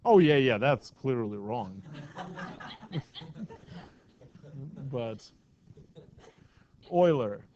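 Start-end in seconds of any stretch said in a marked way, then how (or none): 1.9-3.9 breathy, voiceless laugh. Real, not acted. Teacher owns up to a misspelling in class, soft laugh. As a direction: laugh softly at your own slip. Laughs at own spelling mistake.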